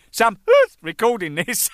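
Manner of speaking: high, loud, slow words broken by laughter; helpless laughter through the line; laughing quote. Retold or imitated. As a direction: crack up while acting out the quote. Laughing while quoting the tape discovery.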